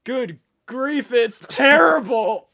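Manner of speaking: slow, loud, high anguished cry; dramatic wail. Acted. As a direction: wail it out in hammy agony. Improv, suffocating astronaut.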